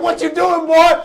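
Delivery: put-on high, loud demand to a child; mama's startled shout. Mama finds Johnny up, high shouted question. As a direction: yell it as the mother in the sermon.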